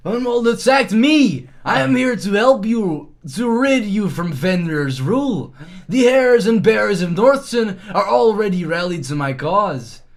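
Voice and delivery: high, loud insistent plea; loud protest. Acted. Player insisting to the dogs he came to help, loud protest. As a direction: raise your voice to convince them.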